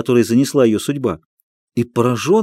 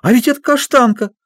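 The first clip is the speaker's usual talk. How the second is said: put-on high amazed child's shout; excited child; 10 semitones higher than usual. A narrator's reading. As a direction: cry out in recognition. Child recognizes the dog. (радостный детский крик)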